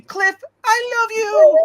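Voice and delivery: high, slow swooning wail; mock lovesick cry. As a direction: swoon it out like a fan. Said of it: "you've been saying".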